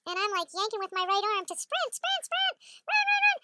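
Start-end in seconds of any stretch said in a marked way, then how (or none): 1.6-3.4 high-pitched frantic urging; maniac yelling. Retold or imitated. Urging the dogs away from a raccoon, frantic high yelling. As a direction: urge the dogs on in rapid cries. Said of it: "So I'm yelling like a maniac".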